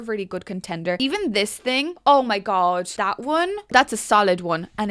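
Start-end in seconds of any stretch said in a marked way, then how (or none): none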